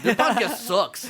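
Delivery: loud, drawn-out jeer with laughter; mock review outburst. Reviewer trashing someone's podcast, loud mock jeer.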